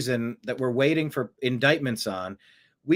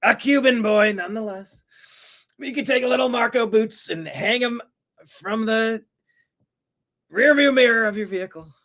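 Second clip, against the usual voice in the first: mock official; 6 semitones higher, 3 dB louder than usual. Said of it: "They said".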